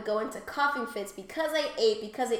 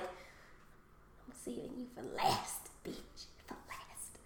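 hushed, slow whisper to the lasagna; slow menacing whisper; whispered threat; 14 dB quieter, much slower than usual. Retold or imitated. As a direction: whisper it like a secret threat to food.

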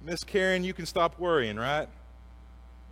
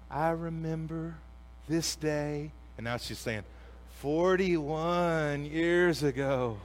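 tender mother's voice; 4 dB quieter, slower than usual. Retold or imitated. Mom's birthday call, quoted.

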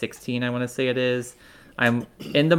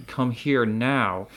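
slow, insistent summons; stern demand; much slower than usual. Retold or imitated. Friend insisting he come over now, slow and firm. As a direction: lean on each word like a bossy friend.

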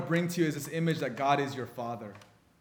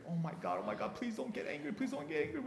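frightened hushed pleading, much softer; fearful kids; 8 semitones higher, 12 dB quieter than usual. Retold or imitated. Kids trembling, begging dad not to get angry, whispered. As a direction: beg under your breath, scared. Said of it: "we all shudder in fear".

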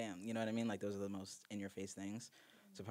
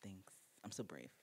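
sarcastic; 11 dB quieter than usual. Acted. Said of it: "Oh, my God".

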